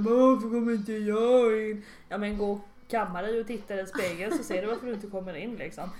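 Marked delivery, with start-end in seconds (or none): 0.0-1.8 put-on whiny drunk.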